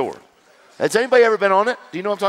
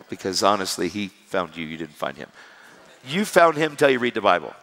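normal talking voice

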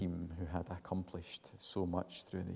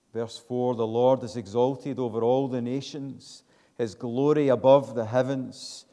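loud, high, ringing psalm reading; preacher reading the psalm aloud to the church; scripture reading; 5 semitones higher, 12 dB louder than usual. Acted. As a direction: proclaim the verses to the congregation.

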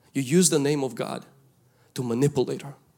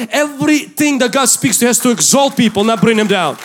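higher, louder preaching, pressing every point; preaching at full force; 5 semitones higher, 6 dB louder than usual. Acted. Pastor urging the congregation, preaching at full force. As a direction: drive the message home to the crowd.

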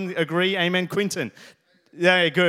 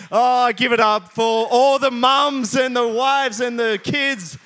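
louder, higher and slower, rallying the room; calling out applause; 6 semitones higher, 9 dB louder, slower than usual. Real, not acted. Preacher rallies the church to applaud the mums, loud rousing call. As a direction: lift the crowd to clap for the mums.

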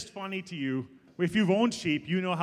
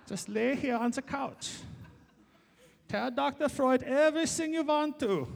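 put-on higher-pitched German-accented psychoanalyst; Doctor Freud, German accent; 6 semitones higher than usual. Preacher playing analyst, inviting everyone onto the couch, comic German accent. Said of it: "to do a German accent".

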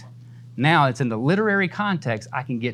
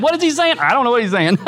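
mock confusion; 8 semitones higher, 4 dB louder, faster than usual. Mocking confusion over the letters.

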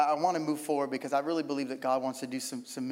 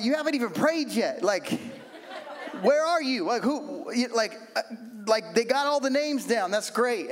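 incredulous; 5 semitones higher than usual. Retold or imitated. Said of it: "I'm like".

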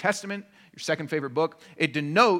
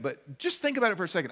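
ordinary delivery

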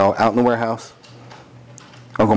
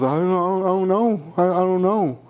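flustered employee; 7 semitones higher than usual. Retold or imitated. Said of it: "And G-Man goes".

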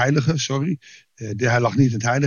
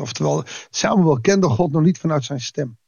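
ordinary talk, nothing marked

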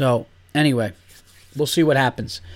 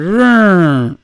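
imitated wiper motor grinding; 5 semitones higher, 7 dB louder than usual. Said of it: "I just heard".

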